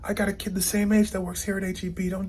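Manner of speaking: high, heated mock yell at the pusher; retold yelling. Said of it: "he starts yelling".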